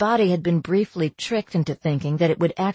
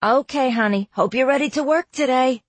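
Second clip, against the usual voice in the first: cheerful mom; 7 semitones higher than usual. Acted. Mom on the phone.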